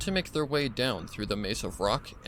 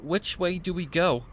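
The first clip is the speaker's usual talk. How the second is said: higher, louder call into the storm; 8 semitones higher, 4 dB louder than usual. Acted. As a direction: shout over the blizzard. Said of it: "Sprout called out".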